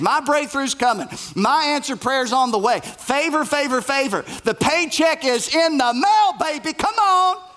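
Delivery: mocking a TV preacher promising favor and money; mock televangelist. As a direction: ham it up like a prosperity preacher on television. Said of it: "I could never be a televangelist".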